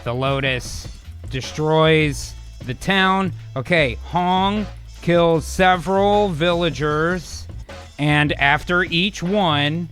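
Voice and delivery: measured, halting dictation voice; slow dictation. Real, not acted. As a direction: spell out the prompt slowly as you type. Slowly dictating a prompt while typing.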